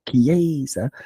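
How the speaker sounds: "Chiesa" with a long, strong AY in the middle; Italian stress. Shown as a demonstration. Podcaster repeating the Italian name, middle-syllable stress. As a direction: stretch the middle of "Chiesa", kee-AY-za. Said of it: "'cause Italian has stress".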